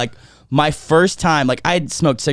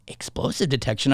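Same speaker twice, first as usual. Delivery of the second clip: disbelieving echo, soft and puzzled; incredulous question; 8 dB quieter than usual. Retold or imitated. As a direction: repeat it in quiet disbelief. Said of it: "I was like".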